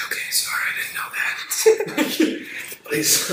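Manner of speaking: whispery, pleading, with a laugh; mock meek apology. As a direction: plead half-laughing under your breath.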